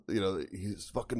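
usual speaking voice

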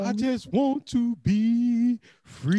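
high, thin "help me" plea; mock pleading voice. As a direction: ham up a desperate crackhead.

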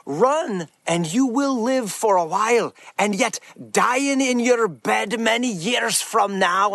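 slow, rallying Scottish battle speech; amateur Scottish accent, addressing the men. Acted. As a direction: do your best Scottish warrior, drawn out. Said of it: "I'm doing my best at my Scottish accent".

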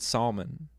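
"salmon" slowly as SAL-mun; L pronounced in salmon. Retold or imitated. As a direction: say "salmon" with a sounded L. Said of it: "I was saying it wrong".

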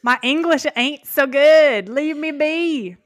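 a hammed-up foreigner brushing someone off; mock broken English. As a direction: play dumb in a slow, broken voice.

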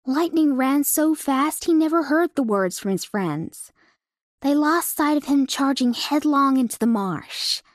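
plain reading voice

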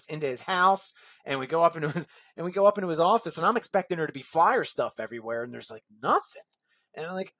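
usual speaking voice

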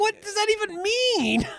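very high, drawn-out disbelief; mock bafflement. Host reacting to a senseless golf metaphor, high mock bafflement. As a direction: squeal the question in mock confusion. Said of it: "It's like".

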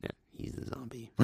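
breathy whispered aside; a spooky little whisper. Real, not acted. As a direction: drop to a hushed whisper. Whispered aside about eating kid.

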